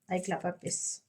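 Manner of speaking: hoarse, strained by illness; sore throat. Real, not acted. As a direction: speak through a sore throat. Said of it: "je tousse parce que j'ai une angine". (voix enrouée par une angine)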